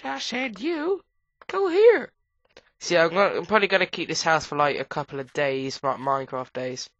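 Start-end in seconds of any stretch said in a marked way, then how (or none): 0.0-2.1 high, loud, drawn-out order; mock-stern telling an item where to go; bossy command. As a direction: insist like scolding a pet.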